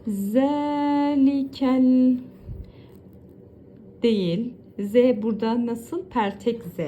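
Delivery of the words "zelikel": Zelikel drawn out slowly, Z lisped; slow drawn-out recitation. Shown as a demonstration. Teacher to students, reciting Zelikel slowly. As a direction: chant Zelikel slowly with the long vowel stretched. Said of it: "devam edelim".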